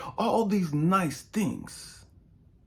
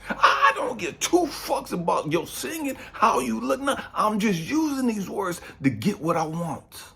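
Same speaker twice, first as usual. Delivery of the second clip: voicing the player; 6 semitones higher than usual. Preacher voicing a player's real motives, crude and blunt. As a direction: spell out what the man really wants. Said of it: "His motives is".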